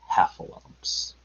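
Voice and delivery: slow, hushed dread; ominous whisper. A narrator's reading. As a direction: whisper the dreaded word, drawn out. The dreaded word in Piglet's head.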